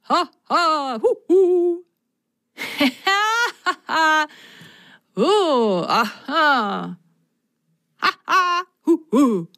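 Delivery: high hooting laughter; audience laughing. Acted. Circus audience laughing at the magician. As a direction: laugh and hoot like a crowd. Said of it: "das Publikum begann jetzt laut zu lachen".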